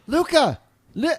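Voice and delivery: high startled call of a name; surprised shout. Acted. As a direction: cry out the name in shock. Waking stranger mistaken for friend.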